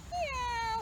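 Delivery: high squeaky meow; cat meow. Acted. Reading to a child, a high cat meow. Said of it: "said Cat".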